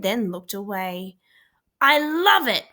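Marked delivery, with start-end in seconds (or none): 1.8-2.7 a loud, high hoot of delight; lightly voiced uncle hooting praise. A narrator's reading. Uncle hoots to Rosie about the cheese hat, mock delight. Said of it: "Fred hooted".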